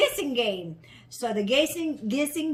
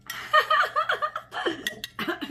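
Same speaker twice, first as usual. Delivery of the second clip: high-pitched laugh. Real, not acted. Laughs after first taste.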